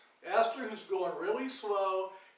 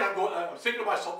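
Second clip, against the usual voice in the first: plain reading voice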